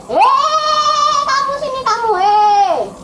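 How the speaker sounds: high, loud, drawn-out hail; calling out. Acted. Servant summoning the friend he meets, loud high call. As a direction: yell across the road for him.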